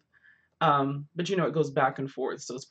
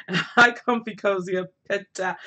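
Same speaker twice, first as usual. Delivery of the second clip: loud greeting; 3 semitones higher, 6 dB louder than usual. Real, not acted. Greeting viewers by name loudly.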